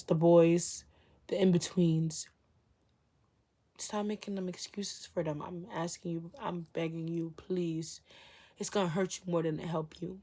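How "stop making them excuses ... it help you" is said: soft pleading. Real, not acted.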